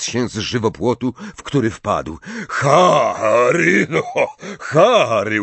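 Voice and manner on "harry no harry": loud, drawn-out happy hail; delighted greeting. A narrator's reading. Hagrid meets Harry on the road, delighted. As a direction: greet an old friend with big delight. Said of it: "ucieszył się Hagrid".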